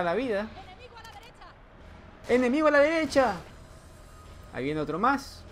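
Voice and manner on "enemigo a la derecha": loud, higher callout; calling out. Acted. Gamer warning teammates of enemy, loud callout. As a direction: shout a warning to the team.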